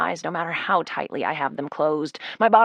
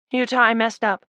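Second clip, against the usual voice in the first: desperate; 4 semitones higher, 4 dB louder than usual. Acted. Desperate confession.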